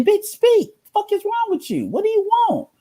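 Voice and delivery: mocking dudes snapping. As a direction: squeal it out like a fed-up guy mocked. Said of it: "They, they say".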